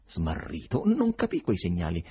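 ordinary delivery

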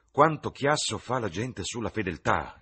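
high-pitched, emphatic outburst; exclaiming. A narrator's reading. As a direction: exclaim it with animated scorn for the fuss. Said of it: "esclamò Lord Henry".

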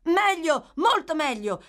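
high, loud, eager good news; hurried eager reply. Acted. Boy giving the gentleman good news, eager. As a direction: burst out with the answer. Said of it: "si affrettò a rispondere Oliver".

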